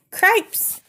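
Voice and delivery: high shocked cry; shocked shout. Acted. Brother mouse shouts on seeing Despero with the princess. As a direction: yelp it out in alarm. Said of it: "shouted Furlow again".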